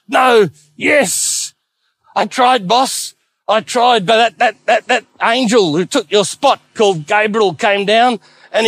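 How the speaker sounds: high, panicky, breaking babble; a terrified imp stumbling over every word; shaken, hardly speaking. Acted. Death stammers to the devil about the angel Gabriel, panicking. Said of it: "shaken up so badly he could hardly speak".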